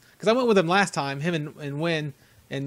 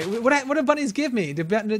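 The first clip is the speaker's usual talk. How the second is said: normal talking voice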